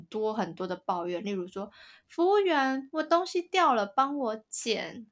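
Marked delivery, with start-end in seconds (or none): 2.1-5.1 mock spoiled girlfriend. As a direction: ham up a spoiled girl calling the staff, slow on the last words. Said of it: "我刚刚为什么要学她讲话".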